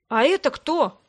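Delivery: put-on young man taken aback; astonished. A narrator's reading. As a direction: ask it in startled bewilderment. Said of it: "изумлённо спросил молодой голос".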